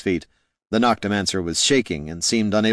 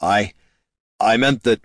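flustered; 3 semitones higher, 5 dB louder than usual. A narrator's reading. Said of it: "Jin tried to recover".

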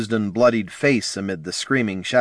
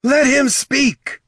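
high, loud, drawn-out command; scream; 5 dB louder, much slower than usual. A narrator's reading. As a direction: scream to silence a mob. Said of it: "Ashurzu screamed".

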